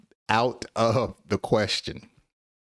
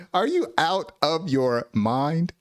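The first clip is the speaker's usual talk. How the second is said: incredulous questioning; 6 semitones higher than usual. Acted. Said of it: "you're basically insulting someone".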